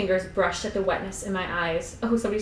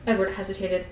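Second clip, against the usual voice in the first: ordinary delivery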